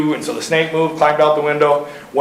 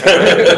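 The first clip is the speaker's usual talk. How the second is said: short laugh; 2 semitones higher, 6 dB louder than usual. Real, not acted. Laughs at own story.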